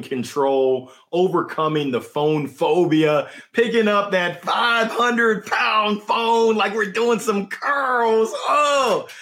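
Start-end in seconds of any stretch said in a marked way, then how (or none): 3.5-9.1 high, strained weightlifting voice; mock straining. Host jokes the phone is a dumbbell, mock straining. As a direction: pretend to heave a heavy weight.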